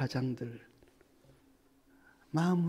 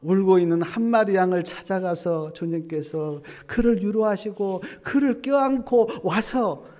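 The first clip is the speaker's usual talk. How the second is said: rising to much louder, higher preaching cry; building preaching; 4 semitones higher, 12 dB louder than usual. Real, not acted. Preacher building to the climax for the congregation. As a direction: build to a full-force climax.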